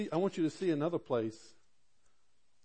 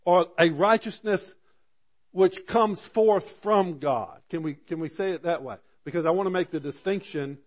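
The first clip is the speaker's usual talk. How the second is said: full-force preaching; 4 semitones higher, 6 dB louder than usual. Acted. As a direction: preach it out to the church.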